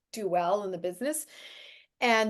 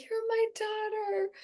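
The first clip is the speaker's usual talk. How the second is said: slow, much higher, soft put-on voice; Marlon Brando; 12 semitones higher, 5 dB quieter, slower than usual. Brando greeting his film daughter with a hug, slow high imitation. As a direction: lightly imitate an old star, drawn out and high. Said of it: "and he's like".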